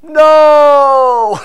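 high, loud one-word shout back; shouted reply. Retold or imitated. Stranger on shore shouting back to the boat. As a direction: bark a flat no from far off. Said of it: "Somebody from shore goes".

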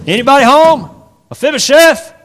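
shouted call. Acted. Ziba banging again and shouting for him, loud high call. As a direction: yell it through the door.

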